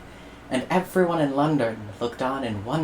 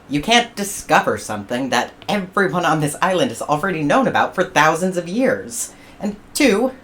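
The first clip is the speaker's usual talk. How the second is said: rising louder, higher lecture; platypus rant; 5 semitones higher, 5 dB louder than usual. Acted. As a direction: tell him off point by point.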